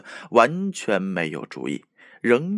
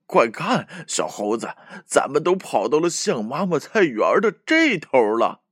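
big gasping breaths, higher; 5 semitones higher, 3 dB louder than usual. A narrator's reading. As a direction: huff it out between breaths. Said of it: "大口喘气地说".

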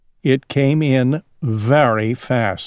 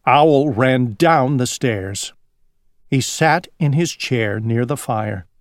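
usual speaking voice

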